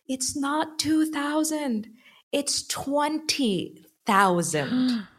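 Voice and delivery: high, drawn-out imitated scream; mock screaming. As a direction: stretch each word in a high-pitched retold cry. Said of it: "he starts screaming".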